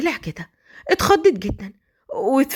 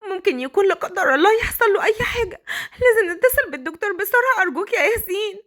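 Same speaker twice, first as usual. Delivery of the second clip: much higher, indignant then pleading; high and urgent, rebuking him; shocked outrage; 8 semitones higher than usual. Acted. Wife rebukes then begs Yassin. (صوت عالٍ مستنكر ثم متوسل)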